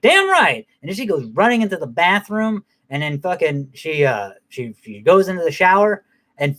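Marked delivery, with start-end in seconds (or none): 0.0-0.7 high, loud triumphant cheer. Retold or imitated. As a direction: cheer her on like a fan at a fight. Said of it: "I'm like".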